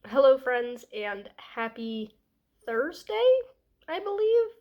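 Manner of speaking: ordinary talk, nothing marked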